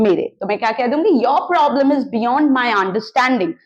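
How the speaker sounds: ordinary delivery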